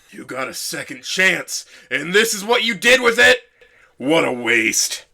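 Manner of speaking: slow, bitter father's reproach, rising high on the question; a hint of a disappointed father. A narrator's reading. Father scolding his son over his wasted chance, bitter. Father's harsh words remembered.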